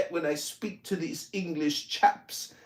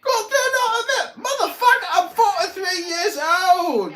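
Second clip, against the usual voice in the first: higher, faster, laughing disbelief; indignant disbelief; 8 semitones higher, 3 dB louder than usual. Real, not acted. Incredulous comeback to the heckler's military claim, laughing outrage. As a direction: scoff at the absurd accusation.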